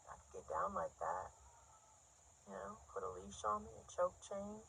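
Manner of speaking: very soft, hesitant big-guy voice; welding giant. Acted. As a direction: make the shy proposition under your breath. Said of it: "looks down at me and goes".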